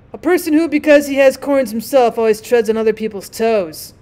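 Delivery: usual speaking voice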